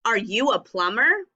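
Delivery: rising question ending on PLUMBER; teacher modelling a question to someone; asking a question. Shown as a demonstration. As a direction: ask it, lifting the voice on PLUMBER. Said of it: "I'm asking someone if they're a plumber".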